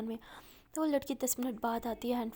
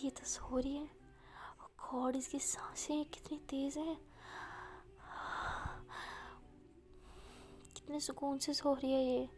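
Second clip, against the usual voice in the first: tender whisper; 2 semitones higher, 7 dB quieter than usual. Acted. Listening to her breathing on call.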